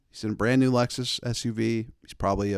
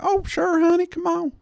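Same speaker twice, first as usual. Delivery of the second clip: put-on high, sweet old lady voice; old lady calling to a stranger, high and sweet; old Nashville lady. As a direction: ask politely like an elderly Southern lady.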